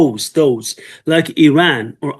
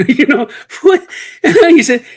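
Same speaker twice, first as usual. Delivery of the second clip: laughing; 9 semitones higher, 5 dB louder than usual. Real, not acted. Laughing while retelling a story.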